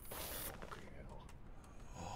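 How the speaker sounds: very soft, slow, low curse; muttered curse. Real, not acted. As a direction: breathe it out defeated. Muttered after failure.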